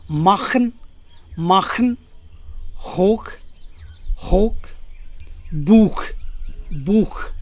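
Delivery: माखन, होक, बूख with throaty "kh"; German ch as "kh". Shown as a demonstration. Teacher drills ch after a, o, u, "kh" in माखन, होक, बूख. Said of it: "तो sound निकलेगा ख".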